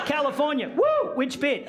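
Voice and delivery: high whooping mock cheer for California; mock excited cheer. A local hearing the place name, mock whooping. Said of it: "I just say that".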